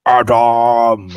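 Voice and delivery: huge, booming, drawn-out shout; Goliath yell. Acted. Game master as a Goliath calling across a crowd, bellowing. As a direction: bellow the name so it echoes down alleys. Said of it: "it's a Goliath yelling".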